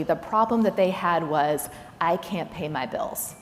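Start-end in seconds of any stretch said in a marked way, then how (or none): none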